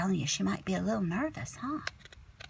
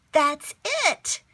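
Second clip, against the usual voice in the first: high, louder discovery; eureka; 9 semitones higher, 5 dB louder than usual. Acted. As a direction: snap onto the answer. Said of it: "I said".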